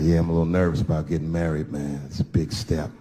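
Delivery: friend voiced low. Nervous groom confiding in Dave, low voice. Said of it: "He said".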